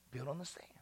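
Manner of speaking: breathy whisper of warning. Real, not acted. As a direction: whisper it like a secret. Whispered point about sand.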